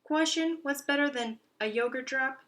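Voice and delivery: high, loud teasing question; gleeful hamster riddle; playful quiz. Acted. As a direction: build up the riddle.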